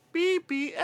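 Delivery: put-on high childlike voice; little kid voice. Shown as a demonstration. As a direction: say it like a small kid. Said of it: "the little kid drop".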